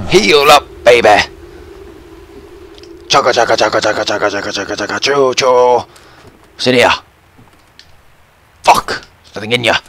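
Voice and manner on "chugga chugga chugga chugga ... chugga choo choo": rhythmic chugging train noise; train imitation. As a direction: chug along evenly, ending on a choo-choo.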